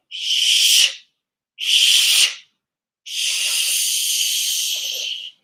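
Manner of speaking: three sharp hissing shh breaths out; forceful shh. Shown as a demonstration. Teacher showing the class the warrior breath, loud shh. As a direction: push the air out hard on each shh. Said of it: "Watch me".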